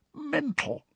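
soft, high-pitched croak; croaky whisper. A narrator's reading. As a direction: whisper it hoarsely, aghast at yourself. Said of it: "he said in a croaky whisper".

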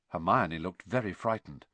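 usual speaking voice

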